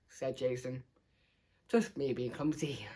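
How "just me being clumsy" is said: downplaying. A narrator's reading. Child covering up the noise.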